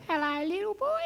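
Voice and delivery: put-on high, soft little old lady voice; sweet old lady calling hello; a lady's high, soft hello from the gate; old lady greeting.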